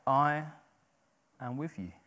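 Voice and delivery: quiet promise. Acted. God's promise, softly repeated.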